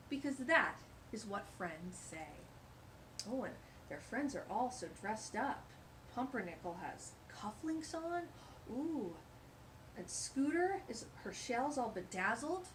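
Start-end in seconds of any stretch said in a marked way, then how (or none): none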